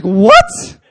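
high, very loud yelp of disbelief; hammed-up startled outburst; mock shocked yell. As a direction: imitate a stunned kid, full volume. Son's startled reaction, acted.

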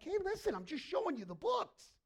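high, loud acted pleading; loud protest. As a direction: protest your innocence at full volume. God pleads with angry Pharisees.

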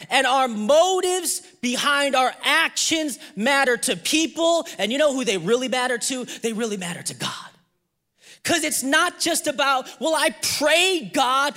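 high, punched sermon delivery; emphatic preaching. Real, not acted. Preacher tells congregation motives matter to God, emphatic. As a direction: drive the point home to the congregation.

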